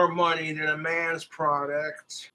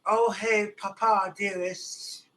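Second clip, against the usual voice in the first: put-on sweet-talking child; 3 semitones higher, slower than usual. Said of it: "I hate being like".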